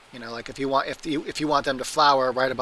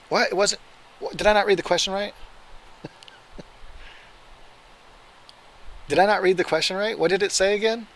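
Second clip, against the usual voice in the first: surprised disbelief; 6 semitones higher than usual. Real, not acted. Misread quiz question, disbelief.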